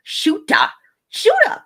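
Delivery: high, loud hype call-out of a fan's name; hype shout. Acted. Hyping a fan's name to the stream, loud and high. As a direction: yell the name like a hype man.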